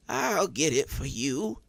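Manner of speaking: put-on rasping frail old woman; raspy. A narrator's reading. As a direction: rasp it out, insisting. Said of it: "Abby rasped".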